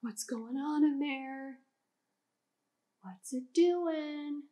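hushed suspense. Acted. Teacher peering at the container, hushed slow wonder. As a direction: lean in and ask in a hush.

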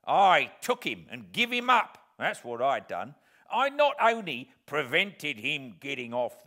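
loud, slow, scornful rant; disdainful. Acted. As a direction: sneer at the idea with contempt. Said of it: "said my convict disdainfully".

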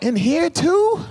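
high, quick rising disbelief; mock shocked question; surprised question. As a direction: ask it like you just caught the smell. Smelling friend's car.

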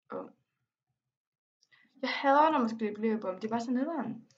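usual speaking voice

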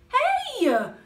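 high, loud indignant cry; a sharp startled yelp of protest; Polly cries out. Acted. Polly at greedy cats, high loud cry. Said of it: "cried Polly".

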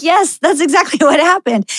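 high and laughing through the words; laughing answer. Real, not acted. Answering the old lady's joke, she laughs and agrees. Retelling her reply, laughing.

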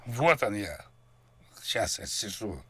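ordinary talk

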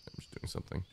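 whispered aside to herself. Real, not acted. Busy in the game, whispering to herself. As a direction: murmur it under the breath.

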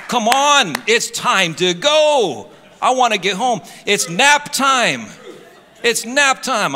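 loud, high, hammed-up whine; comic pleading to get out of the store; impatient protest. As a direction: stretch out the words in mock exasperation. Acts out impatience while shopping.

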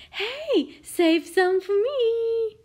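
put-on high, loud child's call; eager little princess. A narrator's reading. Princess wanting some dinosaur eggs, eager. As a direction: call out slowly, wanting a share.

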